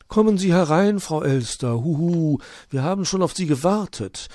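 high friendly owl voice with a huhu. Acted. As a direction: hoot a welcome at the door. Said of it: "empfing sie Onkel Uhu freundlich".

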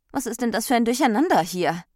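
high, loud, quick demand; indignant question. A narrator's reading. Ordnung confronts the muddle in her house, indignant. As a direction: demand an explanation for the mess.

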